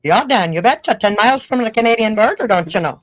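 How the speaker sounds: loud, hammed-up northern Minnesota accent; exaggerated small-town Minnesotan near the Canadian border. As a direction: lay the Minnesota accent on thick, bouncy and loud. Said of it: "That's a pretty good accent".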